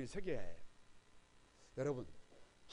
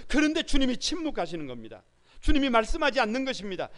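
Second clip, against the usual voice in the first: higher and much louder impassioned sermon; preaching at full force; 11 semitones higher, 12 dB louder than usual. Acted. Pastor urging the congregation to cry out, full force.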